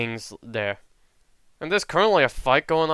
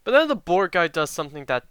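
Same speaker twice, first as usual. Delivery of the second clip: usual speaking voice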